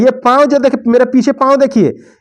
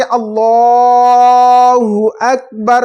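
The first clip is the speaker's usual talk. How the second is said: imam's drawn-out call; imam's takbir; much slower than usual. Shown as a demonstration. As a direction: call it out like the imam leading prayer. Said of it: "इमाम साहब कहेंगे".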